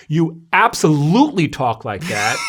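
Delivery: loud, drawn-out stress on "absolutely"; emphatic retort. Retold or imitated. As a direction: insist teasingly, leaning hard on "absolutely". Teasing retort to protesting colleague.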